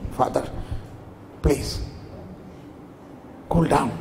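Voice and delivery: soft, low, halting appeal; gently calm an angry father, with pauses; soothing plea. Retold or imitated. Abraham soothes angry father, acted.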